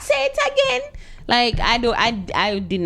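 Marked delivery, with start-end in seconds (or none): 0.0-0.9 mocking request in a much higher voice. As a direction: stretch it out high to poke fun. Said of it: "but you kinda mock them".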